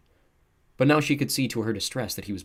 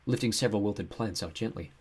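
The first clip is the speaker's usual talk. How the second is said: ordinary delivery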